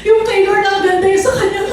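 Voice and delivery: high, laughing, puzzled protest; laughing complaint. Retold or imitated. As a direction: laugh through the mock grievance. Retelling her puzzled reaction laughing.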